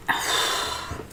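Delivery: quiet voiceless tired sigh; soft breathy sigh. Real, not acted. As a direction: breathe out the fatigue softly. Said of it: "wake up".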